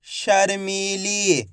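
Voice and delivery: शर्मीली drawn out syllable by syllable; slow शर्मीली with long ee vowels; blended word demo. Shown as a demonstration. Teacher joining letters for pupils, शर्मीली stretched slowly. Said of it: "तो मिलके बन जाएगा".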